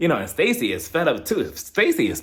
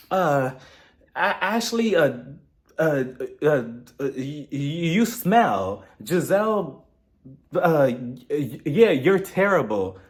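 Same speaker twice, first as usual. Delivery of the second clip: halting mock accusations, stretched out; fumbling for insults; slower than usual. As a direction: stall and stammer, then land a lame insult. Pretends inventing fake drama on the spot.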